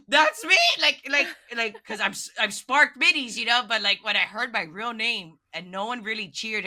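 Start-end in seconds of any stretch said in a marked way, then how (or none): 0.1-3.0 high, loud joyful cry of recognition; excited exclaim. Retold or imitated. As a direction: shout it as your name is announced. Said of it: "and I'm just like".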